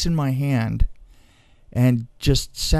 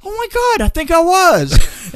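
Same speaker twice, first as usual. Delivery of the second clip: high, loud shocked cry; astonished realization; 6 dB louder than usual. Retold or imitated. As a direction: shout it, amazed at yourself. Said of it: "nobody was more surprised than I was".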